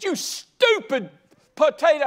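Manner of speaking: high, slow, loud yell at a potato; hammed-up rage at a microwaved potato; mock angry outburst. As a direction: snap at the potato in fake fury.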